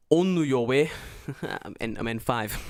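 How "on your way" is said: loud mock-Japanese accent; hammed-up katakana-style Japanese English. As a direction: sound out each syllable in a Japanese accent. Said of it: "as the Japanese would say".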